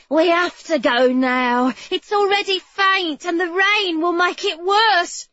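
put-on light, pressing young woman's voice; a hint of a desperate girl; urgent girl character voice.

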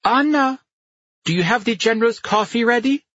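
put-on loud high call, German accent. A narrator's reading. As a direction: call out across the apartment. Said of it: "called out".

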